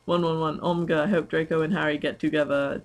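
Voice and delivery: normal talking voice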